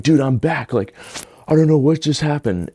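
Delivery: high-pitched excited disbelief, retold; amazed, elated. Telling his friend he's back, amazed and elated. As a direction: blurt it out, amazed at what happened.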